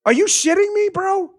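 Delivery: a stunned what-are-you-kidding-me; incredulous. Real, not acted. Host reacting to a senator's shrug, incredulous. Reacting to a candidate's dismissive answer.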